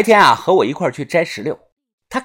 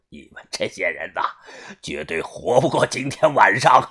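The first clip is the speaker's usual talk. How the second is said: low, softer villain voice with a laugh in it; quiet, lower gloating laugh through the words; 8 semitones lower, 3 dB quieter than usual. Acted. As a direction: laugh it out low and smug, like an unkillable henchman. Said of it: "口中大笑道".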